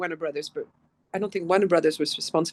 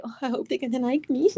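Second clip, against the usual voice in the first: mock timid actress; 3 semitones higher, faster than usual. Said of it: "the actress is like".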